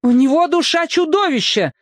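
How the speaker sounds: high shrill outburst of rage; fly up at him furious; flaring up. A narrator's reading. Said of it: "взвилась Дульсинея".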